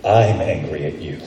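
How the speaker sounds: low, restrained anger; be angry yourself, voice dropped low; truly felt anger. Shown as a demonstration. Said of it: "The way you be it is you say".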